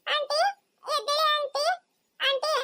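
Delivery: put-on high child's voice, anxious and pleading; small girl begging the hurt officer to answer; worried little girl. Child begs aunty to wake. (ಆತಂಕದ ಪುಟ್ಟ ಹುಡುಗಿ)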